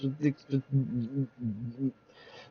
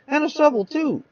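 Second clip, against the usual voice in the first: squeaky accusation; 11 semitones higher than usual. Acted. Witness adds damning detail.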